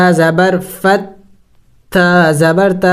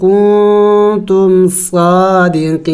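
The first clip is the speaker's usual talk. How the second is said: Quran recitation. Acted. Continues recitation.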